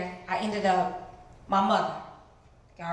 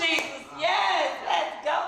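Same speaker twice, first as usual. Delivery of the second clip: excited gushing; 5 semitones higher, slower than usual. Retold or imitated. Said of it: "I'm like".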